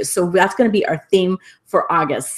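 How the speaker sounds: normal talking voice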